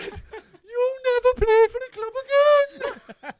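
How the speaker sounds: high, slow squeaky threat; high mock gaffer. Gaffer threatening player caught out late, high mock voice. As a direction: drag it out in a silly high boss voice. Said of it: "He said".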